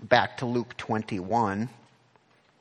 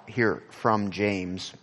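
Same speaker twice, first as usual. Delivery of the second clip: ordinary delivery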